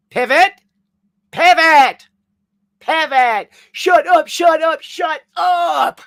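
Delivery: high, loud, escalating shouts; hammed-up frantic yelling. Streamer doing a running bit with Ryan, frantic yelling. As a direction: yell it in comic exasperation, building to the last word.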